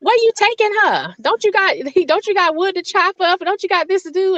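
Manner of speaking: high-pitched imitation of a fussing mother; high mom voice. Mom trying to stop dad from taking her daughter, high mock fussing. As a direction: squeak out mom's protest, laughing through it.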